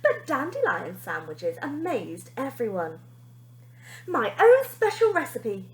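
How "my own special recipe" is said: beaming. A narrator's reading. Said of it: "he beamed".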